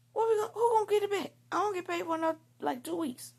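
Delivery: high-pitched, fast panicked outrage; retold indignant freak-out; mad. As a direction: fire worried questions at him, pitch way up. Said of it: "Now I'm mad".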